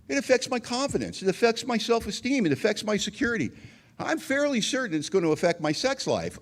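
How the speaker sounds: high-pitched, self-pitying listing; acted aggrieved complaint. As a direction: pile up the grievances with mock indignation. Aggrieved list of effects.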